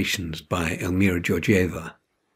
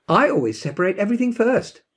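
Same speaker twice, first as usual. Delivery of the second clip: demanding; 10 semitones higher than usual. A narrator's reading. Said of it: "demanded Janie".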